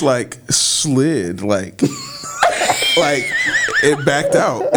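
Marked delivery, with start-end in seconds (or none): 1.8-2.9 real burst of laughter, climbing high; long high laugh. Real, not acted. Cracking up at the rapper who ran away, laughing high. Laughing while telling the story.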